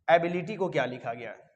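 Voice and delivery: ordinary talk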